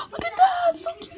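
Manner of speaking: high gasping squeal of delight; overwhelmed by cuteness. Real, not acted. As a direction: melt over an adorable animal. Mom gasps at cute animal.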